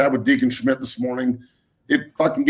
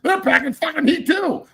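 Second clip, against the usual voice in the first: high, heated rant pitched way up; fired-up rant; 10 semitones higher than usual. Real, not acted. Host ranting on air about armed kids, high fired-up rant. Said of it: "I'm getting a little fired up".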